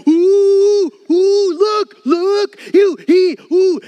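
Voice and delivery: put-on very high, loud taunting "ooh, look" like a tattling child; mocking devil. The devil gloating as we take the bait, high loud taunt. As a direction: squeal it out, gleeful and accusing. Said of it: "he's like".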